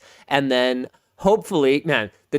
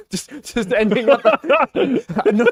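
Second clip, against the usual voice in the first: laughing; 6 semitones higher than usual. Real, not acted. Laughing at own joke.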